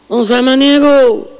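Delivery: high, slow call to the healer from the gate. Acted. Patient calls out to the healer.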